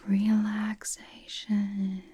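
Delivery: very slow, stretched syllables; blissful drawn-out word. Acted. Host extolling the couch, slow and blissful.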